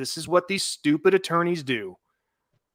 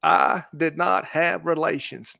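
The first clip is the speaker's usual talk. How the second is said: slow, drawn-out Clinton denial; a lightly voiced Bill Clinton, spelled out slowly; a hint of Clinton's slow, folksy denial; Bill Clinton impression; slower than usual. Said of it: "my best Bill Clinton impersonation".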